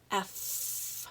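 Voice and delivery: "F" said as a long breathy f sound, not the letter name; f sound demo. Shown as a demonstration. Teacher shows the child the f sound at a word's end. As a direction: blow a long f over the lip. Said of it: "my tongue goes over my bottom lip".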